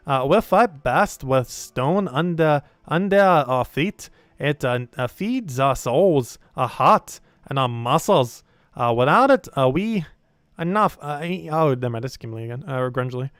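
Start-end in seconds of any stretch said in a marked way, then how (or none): 0.1-11.0 put-on slow, rolling dwarf accent, pitch swooping; emphatic, halting dwarf delivery. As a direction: speak as a proud stone-loving dwarf. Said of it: "we don't get to do dwarf voice".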